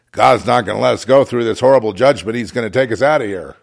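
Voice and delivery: mock believer's recitation; imitating the self-assured saved; mocking imitation. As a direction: parrot their prophecy mockingly. Mimics others' smug prophecies.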